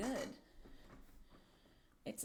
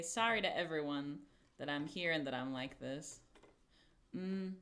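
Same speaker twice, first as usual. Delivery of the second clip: usual speaking voice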